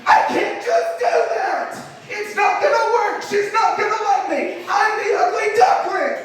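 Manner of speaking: very high, hammed-up wail; panicked outburst. Boy sure she'll reject him, hammed-up panicked wailing. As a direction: cry out in mock despair, pitch way up. Acted panic after the mock proposal.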